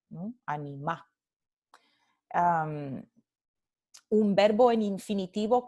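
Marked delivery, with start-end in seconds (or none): 0.5-1.1 "Anima" stressed on the last a, no L; softer, lower a-ni-MÁ for "Anima"; dropped final L. Shown as a demonstration. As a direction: repeat "Anima" Andalusian-style, ending on the stressed vowel. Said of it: "se pronuncia anima".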